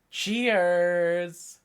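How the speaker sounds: slow, festive toast; cheers. Real, not acted. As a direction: raise a glass to the chat. Toasting with a drink.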